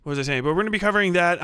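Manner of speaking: usual speaking voice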